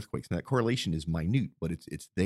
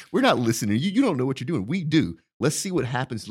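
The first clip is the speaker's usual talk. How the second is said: louder, brash mock retort; defiant; 4 semitones higher, 5 dB louder than usual. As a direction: brush them off, cocky and loud. Mock Railroad Commission's defiant reply.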